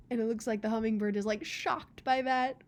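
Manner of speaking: ordinary delivery, nothing marked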